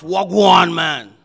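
loud, gruff Jamaican shout of a greeting; heavy gruff Jamaican. Shown as a demonstration. Pastor demonstrating a heavy Jamaican greeting, gruff loud Jamaican accent. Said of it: "in a heavy, mm, gruff".

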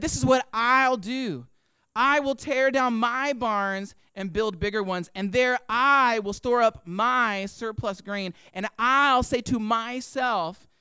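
slow and deliberate, drawing out "I'll", "I", "my" and "myself"; weight every first-person word: "I", "my", "myself"; stress on I and my. Shown as a demonstration. Preacher quoting the rich man's plan, stress on I and my. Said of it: "notice what I emphasize here".

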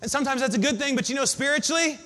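ordinary delivery

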